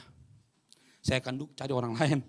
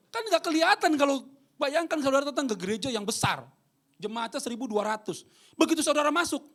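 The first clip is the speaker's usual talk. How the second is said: high, loud pulpit preaching; preaching at full force; 12 semitones higher, 9 dB louder than usual. Acted. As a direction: drive it home to the congregation. (khotbah berapi-api, tinggi dan keras)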